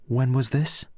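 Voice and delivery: nervous. Acted. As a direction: ask with a nervous edge. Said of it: "said Pedro nervously".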